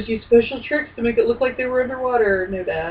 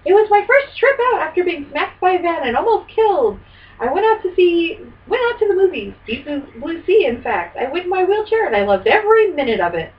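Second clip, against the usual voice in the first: higher, lightly voiced author reading his quote; Stephen King voice; 7 semitones higher than usual. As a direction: do a light, higher take on the novelist. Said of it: "Love your Stephen King voice".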